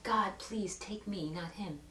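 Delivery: slow, low, soft retold prayer; hushed plea. Daughter praying at her dying dad's hospital bed, hushed plea. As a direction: plead quietly, slowly, trailing to almost nothing.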